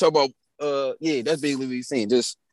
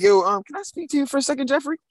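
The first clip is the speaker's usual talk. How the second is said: higher-pitched teacher voice; mock teacher request, pitched up; imitated teacher; 7 semitones higher than usual. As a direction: put on a teacher politely asking for a word. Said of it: "she walked in like".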